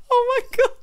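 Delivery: high, loud gasp through laughter; laughing. Real, not acted. Recovering from her own tattling imitation, high laughing voice.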